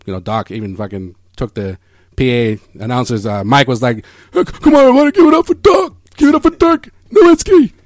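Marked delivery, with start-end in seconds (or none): 4.3-7.7 high, loud announcer hype voice; Doc impression. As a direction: hype up the crowd like Doc on the mic. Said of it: "your Doc voice".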